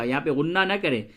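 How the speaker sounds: ordinary talk, nothing marked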